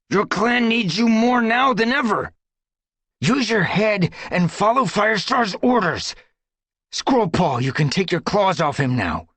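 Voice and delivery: high, loud orders; stern command. Acted. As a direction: order him to obey. Brambleclaw commands Crowpaw.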